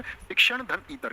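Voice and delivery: शिक्षण धन ईतर said slowly, each part separate; teacher spelling out a word's parts slowly; split into parts. Shown as a demonstration. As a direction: break शिक्षणेतर into शिक्षण धन ईतर, slow and spaced. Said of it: "क्या होता है?".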